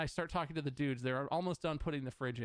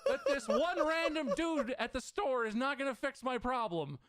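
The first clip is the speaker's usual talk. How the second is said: exasperated; 8 semitones higher, slower than usual. Real, not acted. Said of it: "I almost fucking threw my phone".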